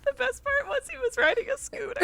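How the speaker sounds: squeaky-high, breaking into laughter; real giggling squeal; helpless laughter. Real, not acted. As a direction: barely get it out before cracking up. Barely gets it out, hilarity.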